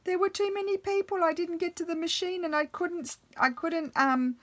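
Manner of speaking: high, whiny retold excuse; mock teary daughter pleading; imitating tearful daughter. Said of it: "And she said".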